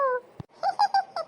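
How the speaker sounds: high, whimpering wail; hammed-up childish crying; high acted sobbing. Little sister crying for sister.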